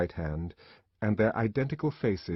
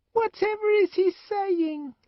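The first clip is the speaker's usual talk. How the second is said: put-on high, loud, piercing child's question; a curious twin piping up; a twin asking about the savage, shrill; 4 dB louder than usual. A narrator's reading. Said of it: "said a voice ... shrill".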